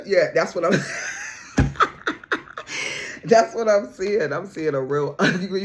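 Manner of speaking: loud, laughing through the words; can't get the words out for laughing; laughing hard. Real, not acted. Reader to viewer, loud real laughter. Cracking up over ugly crying.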